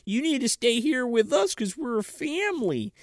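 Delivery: put-on high-pitched childish pleading; hammed-up tearful plea; mock emotional breakdown. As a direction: beg grandma in a put-on little-kid whine. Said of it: "he finally cracks".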